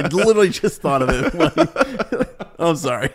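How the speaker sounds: high and slow, laughing through it. Real, not acted. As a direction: giggle while you say it. Laughing at forgotten bacon.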